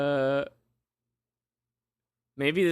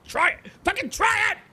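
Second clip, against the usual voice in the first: rising exasperated shout at the screen; frustrated yell. Real, not acted. Yelling at game character.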